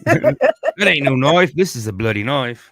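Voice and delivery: laughing Aussie bluster, slow and big; hammed-up Aussie Crocodile Dundee. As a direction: play Dundee sneering at a small knife with a laugh. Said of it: "Crocodile Dundee".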